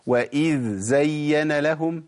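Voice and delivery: very slow وإذ زين with the dhal and the z kept apart; فصل الذال عن الزاي ببطء; correct, dh then z. Shown as a demonstration. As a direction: separate ذ and ز clearly, slowly. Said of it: "الصواب إن أنا أقول إيه".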